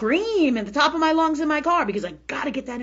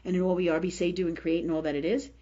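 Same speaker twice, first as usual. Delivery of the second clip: low, hushed run of prayer; murmured prayer; 6 semitones lower, 5 dB quieter than usual. Shown as a demonstration. Demonstrating her healing prayer to listeners, murmured low. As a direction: pray it softly, dropping low as it goes on.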